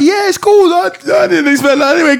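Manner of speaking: high, breezy voice playing it cool; mock casual reply. To the girl, faking not caring, high and breezy. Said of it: "you got to keep it real and be like".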